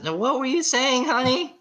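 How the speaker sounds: hammed-up fake calm to son; sweet mom. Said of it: "And she says".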